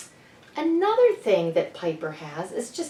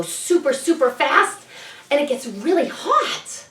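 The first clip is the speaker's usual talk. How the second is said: excited; 7 semitones higher than usual. Acted. Speeding up pretend sanding excitedly.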